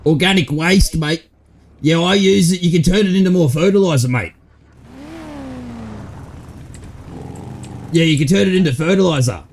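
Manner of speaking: Aussie bloke. Acted. To his mate about the stuck item, a light Aussie bloke voice. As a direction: play a laid-back Aussie dealer. Roleplay character chatting in-game.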